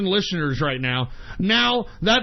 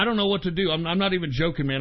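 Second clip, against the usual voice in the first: usual speaking voice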